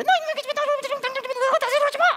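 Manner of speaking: high, loud mock mother yelling; hammed-up shrieking mom; angry mom. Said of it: "둘이 계속 따뜻하게 대화를".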